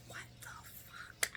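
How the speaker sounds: whispered disbelief, very soft; stunned whisper. Retold or imitated. Reacting to Drew cutting his losses, stunned whisper. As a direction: drop to an incredulous whisper.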